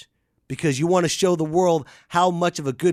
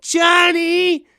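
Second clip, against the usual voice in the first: much higher, louder nagging parent calling Johnny; whiny parent call; 14 semitones higher, 8 dB louder, much slower than usual. Retold or imitated. Mocking a useless parent calling out to Johnny. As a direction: call the kid's name in a helpless, high whine. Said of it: "I've seen parents".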